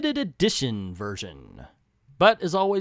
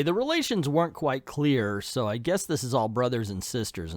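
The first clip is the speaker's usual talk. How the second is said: ordinary delivery, nothing marked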